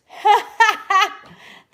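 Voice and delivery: high, loud burst of laughter. Real, not acted. Cracking up over own coaster molds, loud laugh.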